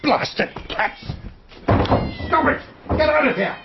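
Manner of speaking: high shouted shooing; shooing cats. Acted. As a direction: chase the cats off the flower beds.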